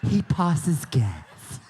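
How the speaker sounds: very slow, dreamy Southern drawl, imitating her; slow mock-romantic Southern drawl; romantic Southern accent. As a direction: make the Southern accent sound romantic and drawn out. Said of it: "It almost sounded romantic".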